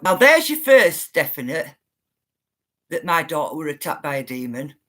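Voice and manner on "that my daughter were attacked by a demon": ordinary talk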